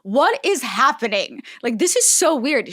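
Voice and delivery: retold baffled reaction, slow first words; baffled disbelief. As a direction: relive being utterly confused. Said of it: "So I'm so confused".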